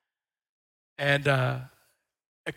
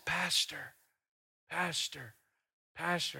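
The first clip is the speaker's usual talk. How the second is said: frail repetition; 2 semitones higher, 7 dB quieter, slower than usual. Retold or imitated. Frail old woman repeating word.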